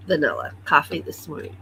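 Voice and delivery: normal talking voice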